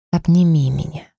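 plain reading voice

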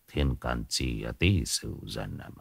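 low, slow, barely audible muttering; soft low mutter over the old book; muttered reckoning. Acted. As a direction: mumble the almanac to yourself. Fortune teller muttering calculations.

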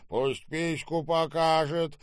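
slow, loud growled demand; mock bass growl. A narrator's reading. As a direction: growl it out, drawing the words. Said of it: "прорычал басом Серёжа". (рычащий выкрик, нараспев)

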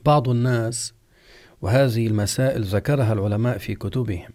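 usual speaking voice

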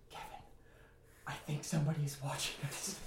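hushed, whispered warning; retold whisper. Scared camper warns roommate someone is watching, acted-out whisper. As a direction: whisper it to your friend in fear. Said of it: "I whisper to Kevin".

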